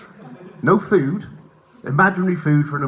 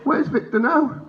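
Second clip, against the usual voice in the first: very high, tight frightened question; unnerved questioning; 12 semitones higher, slower than usual. Acted. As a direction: ask it in a scared squeak. Unnerved dad questions son.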